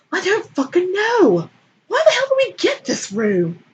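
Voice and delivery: high, loud, exasperated character yell; Baring losing it over the room, high and loud; frustrated Baring voice. Acted. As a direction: squawk it out in a high put-on voice.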